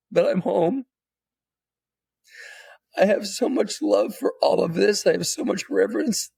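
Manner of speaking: high, halting, choked-up apology and thanks; super emotional. Retold or imitated. Apologising to his family for taking so long, choked up. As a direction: relive the tearful moment, voice lifting. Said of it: "I just started getting super emotional".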